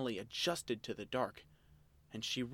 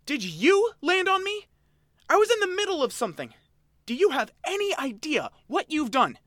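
high, loud indignant creature voice; angry creature; 13 dB louder, faster than usual. Acted. Oshawott scolding the girl who fell on him, loud and high. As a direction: scold her hard and fast, high-pitched. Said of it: "stormed up to her and jabbed".

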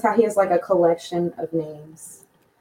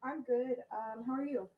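distant, faint and off-mic; far from mic; 5 semitones higher, 13 dB quieter than usual. Real, not acted. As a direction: speak from across the room. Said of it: "You are a little quiet".

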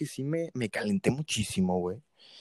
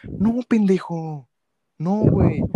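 drawn-out, higher and louder no; loud exasperated no; 5 semitones higher, 8 dB louder, slower than usual. Real, not acted. Talking back to annoying viewers, loud exasperated no. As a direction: shoot the idea down hard. Angry retort to imagined viewer.

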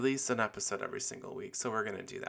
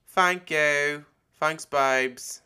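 loud, higher posh British thanks; British accent; 6 semitones higher, 9 dB louder, much slower than usual. Retold or imitated. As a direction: chirp it like a London girl at a bar. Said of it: "British speak".

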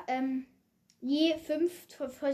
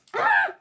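high pained cry; 6 dB louder than usual. Real, not acted. Sour candy makes him cry out.

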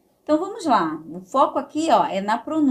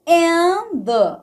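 loud start; 7 semitones higher, 6 dB louder than usual. Shown as a demonstration. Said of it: "ó, and the".

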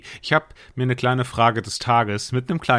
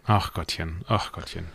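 pitying; 4 semitones lower, 5 dB quieter than usual. Acted. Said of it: "Mitleid von mir".

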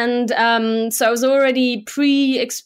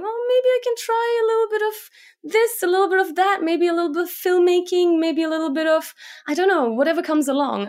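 high, light daydreaming voice; musing inner voice; 7 semitones higher, faster than usual. Retold or imitated. Guest voicing her own daydream, high and musing. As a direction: muse aloud like toying with ideas.

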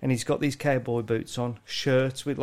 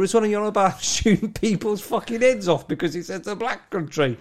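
loud, high mocking disbelief; mock incredulous rant; 6 semitones higher, 5 dB louder than usual. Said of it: "It's like".

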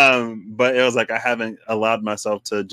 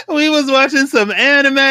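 higher, louder, through laughter; real laughing; 5 dB louder than usual. Real, not acted. Friend admitting to crying over anime, laughing. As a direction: crack up while getting it out.

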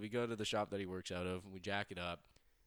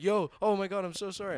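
much higher, louder flustered apology; panicked dad; 11 semitones higher, 10 dB louder than usual. Retold or imitated. Dad finding his kid under the stall door, high flustered apology. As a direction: blurt it like a mortified father. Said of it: "He's like".

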